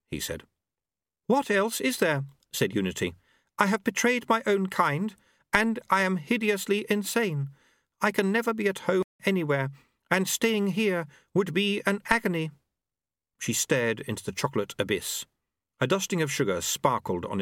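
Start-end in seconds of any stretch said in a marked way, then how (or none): 1.3-12.5 high-pitched, anguished alien lady; high strained woman's voice. A narrator's reading. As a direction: voice her high and despairing. Unity voiced in story.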